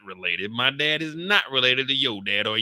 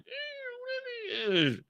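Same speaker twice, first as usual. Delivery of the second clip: smitten guy; 8 dB quieter, much slower than usual. Retold or imitated. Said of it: "it ain't gonna be looking like".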